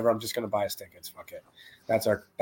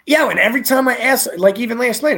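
high, louder exasperated rant; exasperated outburst; 13 semitones higher, 9 dB louder than usual. Real, not acted. Complaining to co-host about Scotty, high and loud. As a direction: throw up your hands.

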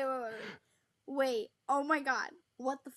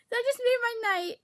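usual speaking voice

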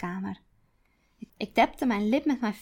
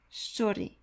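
muttered; 6 dB quieter than usual. Acted. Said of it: "mompelde ik".

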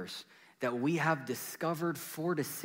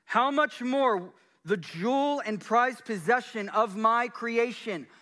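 higher and louder, God proclaiming over music; God speaking; 10 semitones higher, 7 dB louder than usual. Acted. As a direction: declare it as God, with awe. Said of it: "as if God is saying this over you".

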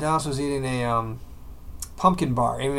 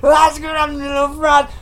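high-pitched garbled wail; imitated bawling, words unintelligible; mock crying; 10 semitones higher, 4 dB louder than usual. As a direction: blubber it like a tantruming toddler. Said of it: "Stop crying so I can understand you".

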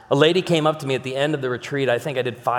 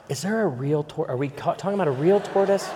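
confused listener; 3 semitones higher than usual. Retold or imitated. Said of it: "some of you right now".

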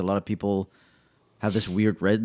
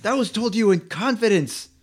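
panicked hush, much higher; frantic hush; 10 semitones higher, 3 dB louder than usual. Real, not acted. Hushing a friend's indiscretion.

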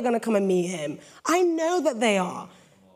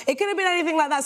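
ordinary talk

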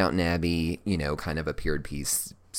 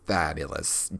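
ordinary talk, nothing marked

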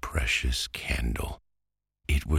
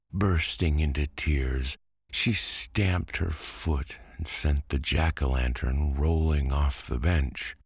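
plain reading voice

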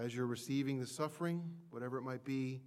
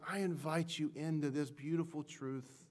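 ordinary talk, nothing marked

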